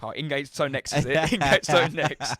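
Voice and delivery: mocking the bloke. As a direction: tease him through a laugh. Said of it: "was like".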